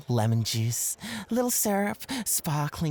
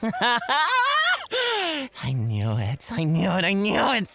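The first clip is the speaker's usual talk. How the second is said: rising, teasing triumph; gleeful I knew it; 7 semitones higher, 4 dB louder than usual. Acted. Host gloating at his rival, teasing. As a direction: gloat a little more each time.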